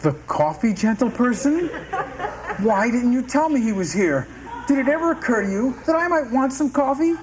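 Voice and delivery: high, rising indignant complaint; indignant outburst. Acted. Outraged about missing coffee.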